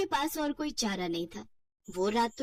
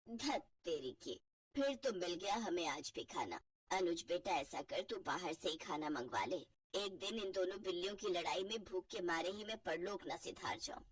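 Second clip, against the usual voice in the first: put-on lower, grumbling old mother-in-law; a hungry, fed-up elderly mother; 5 semitones lower, 11 dB quieter than usual. (भूखी, झुंझलाई बूढ़ी सास)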